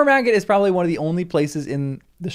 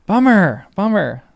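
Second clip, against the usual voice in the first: frustrated groan; 3 semitones higher, 6 dB louder than usual. Real, not acted. Disappointed reaction.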